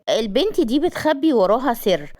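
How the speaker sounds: usual speaking voice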